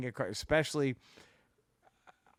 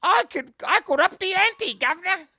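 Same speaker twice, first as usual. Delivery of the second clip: shrill falsetto Monty Python housewife, much higher and louder; Monty Python lady; 9 dB louder than usual. Retold or imitated. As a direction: squawk it like a Python pepperpot. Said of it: "made her like every female Monty Python character".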